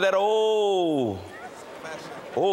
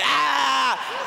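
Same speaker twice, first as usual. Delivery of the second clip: mock tantrum scream; 10 semitones higher, 3 dB louder than usual. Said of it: "he fall down and he kick".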